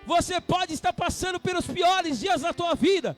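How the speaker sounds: shouting, fervent sermon climax; rally the congregation with a loud call; full-force preaching. Acted. (pregação inflamada aos gritos)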